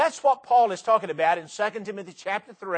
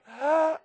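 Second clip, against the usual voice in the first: loud inhale; 9 semitones higher than usual. Shown as a demonstration. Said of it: "take a big old deep breath".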